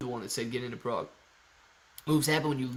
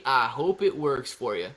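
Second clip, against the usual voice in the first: ordinary talk, nothing marked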